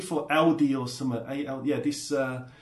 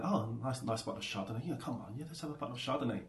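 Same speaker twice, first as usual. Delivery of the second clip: quiet, low retold muttering to himself; sneaky aside; 4 semitones lower, 12 dB quieter, faster than usual. Housemate eyeing the wine in a shared fridge, sneaky aside. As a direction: act out talking yourself softly into it.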